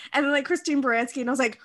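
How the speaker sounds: ordinary talk, nothing marked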